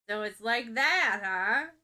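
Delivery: loud, knowing tease full of implication; sly loud insinuating jab; dangerous insinuation. A narrator's reading. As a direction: load every word with a wink. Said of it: "suggests dangerously, tone riddled with implication".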